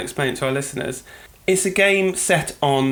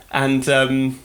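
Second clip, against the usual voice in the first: ordinary delivery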